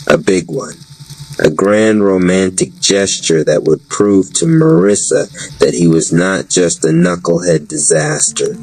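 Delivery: usual speaking voice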